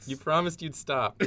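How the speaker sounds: high, drawn-out plea to grandpa; disappointed grandchild. Acted. Same grandchild role.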